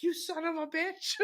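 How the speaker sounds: a high, slowed-down shriek imitating an angry mother; mock furious mom. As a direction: squeal it the way mom did when she was in his face. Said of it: "She's, like, in my face".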